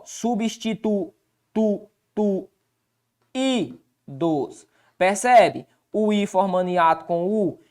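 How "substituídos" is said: Substitu-tu-tu-idos broken into slow separate syllables; teacher splitting Substitu-tu-tu-idos at the board. Shown as a demonstration. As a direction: spell out substituídos syllable by syllable, very slowly. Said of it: "Olha aqui, ó".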